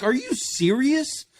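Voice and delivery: high, slow disbelieving "are you serious"; incredulous. Retold or imitated. Man fed up with red pill talk, high incredulous disbelief. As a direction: stretch it out in disbelief. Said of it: "I'm like".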